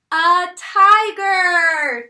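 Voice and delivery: tiger called out loud and slow; excited. Real, not acted. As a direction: tiger drawn out with a big reveal. Teacher naming picture excitedly.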